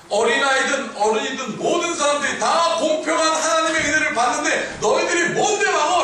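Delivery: high, full-force indignant shout; loud acted scolding from the pulpit; shouting rebuke. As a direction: thunder at the disciples as an outraged preacher. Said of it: "지금 소리치고 계시는 것입니다".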